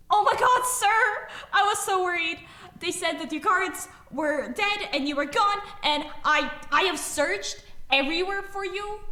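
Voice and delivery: high, loud, overwhelmed outburst; high-pitched, pouring out the worry; very emotional relief. Acted. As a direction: gush with frantic relief at finding someone lost. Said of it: "emotional voice just go".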